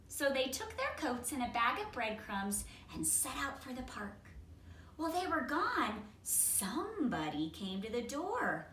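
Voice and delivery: ordinary delivery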